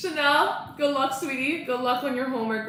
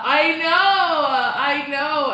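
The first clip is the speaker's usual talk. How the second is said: excited; 6 semitones higher, 10 dB louder, much slower than usual. Real, not acted. Reacting to chat about homework.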